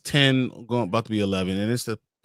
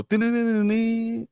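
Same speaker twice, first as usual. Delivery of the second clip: high sung ding ding; high imitated bell chimes; bell imitation; 13 semitones higher, slower than usual. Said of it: "I thought you were gonna go".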